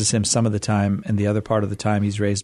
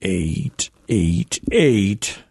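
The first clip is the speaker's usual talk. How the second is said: very slow; 2 semitones lower, much slower than usual. Shown as a demonstration. Host giving callers the phone number, very slow. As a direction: spell out each EIGHT very slowly. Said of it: "Say it slow".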